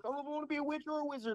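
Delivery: high, quick child impression; imitated little daughter. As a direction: rattle it off high like a little girl. Mimicking his young daughter at play.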